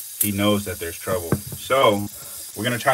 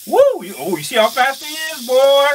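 much higher and louder whoop, then rapid amazed words; excited whoop; 9 dB louder than usual. Real, not acted. Vlogger whoops at the fast snake, excited shout. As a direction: whoop like the snake nearly got you.

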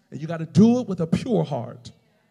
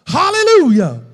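loud, high hallelujah cry; shouted praise; 11 semitones higher, 15 dB louder than usual. Real, not acted. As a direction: burst out in full-voiced praise. Preacher bursts out in praise.

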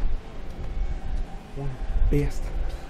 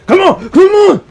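higher, louder desperate yell; frantic urging; 11 semitones higher, 8 dB louder than usual. Real, not acted. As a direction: beg the ball in, shouting. Urging teammate at game climax.

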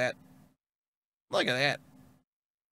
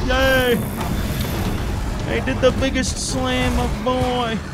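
giddy cheer; 6 semitones higher, slower than usual. Real, not acted. Celebrating the slam.

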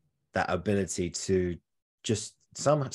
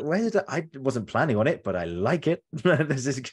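excited delight; 6 semitones higher, 5 dB louder than usual. Retold or imitated. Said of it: "And you go".

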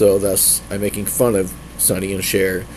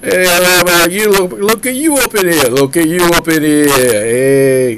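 loud, high, sustained New York holler; exaggerated New York accent, shouted; 6 semitones higher, 6 dB louder than usual. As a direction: belt it like a hammed-up New Yorker. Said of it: "I was trying to go for some, like, New Yorker".

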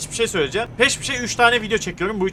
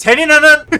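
furious shout; 7 semitones higher, 10 dB louder than usual. Real, not acted. Swearing at another driver.

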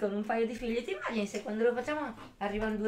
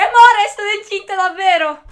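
much higher, louder gushing news; mock excited announcement; 8 semitones higher, 18 dB louder than usual. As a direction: burst out with fake joyful news. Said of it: "il prossimo mese ti posso dire".

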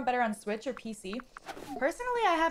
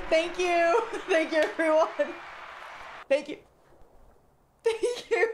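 laughing; 4 semitones higher than usual. Real, not acted. Laughing thanks to chat.

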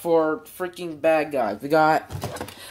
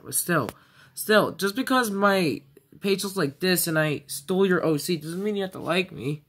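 usual speaking voice

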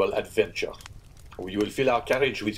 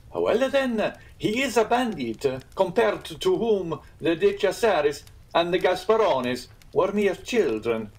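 high, emphatic storyteller, slowing at the end; excitable Italian innkeeper; 10 semitones higher, 4 dB louder than usual. Acted. Innkeeper telling guests of the famous bandit, impressed and emphatic. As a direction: build up the bandit in a lifted voice.

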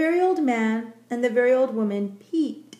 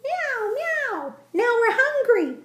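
high cat voices; 12 semitones higher, slower than usual. A narrator's reading. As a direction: pipe it like hungry kittens. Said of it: "said the hundreds of cats".

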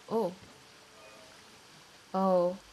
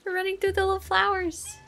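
high, fast and delighted; rapid, high-pitched delight; excited squeal; 9 semitones higher, faster than usual. Real, not acted. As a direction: gush over the little flowers. Cute cat running through flowers.

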